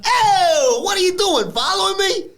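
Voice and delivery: shouted mock Italian-American accent; Italian mobster impression. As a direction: ham up a New York Italian tough guy. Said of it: "And Tony's like".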